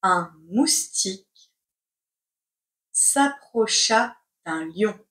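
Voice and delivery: slow, chunked with long pauses; slow dictation. Shown as a demonstration. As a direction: dictate word by word. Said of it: "C'est parti pour la dictée".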